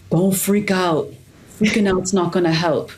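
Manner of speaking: put-on lightly done French accent, calm instructor. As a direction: imitate a French culinary instructor, easy on the accent. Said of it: "But with this heavy French accent".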